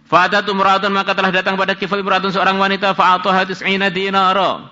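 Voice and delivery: high, loud sustained reading of the text; scripture recitation. Acted. Preacher reading the hadith to students, high loud recitation.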